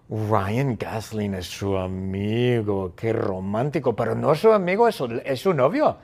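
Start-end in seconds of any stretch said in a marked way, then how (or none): 0.1-3.9 low, slow, lovestruck sigh; drawn-out, dreamy admiration; dreamy swooning. Acted. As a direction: swoon slowly over a heartthrob. Mock swoon over film star.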